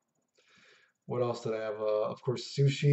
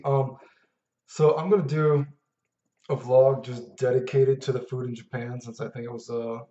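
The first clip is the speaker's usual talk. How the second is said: ordinary talk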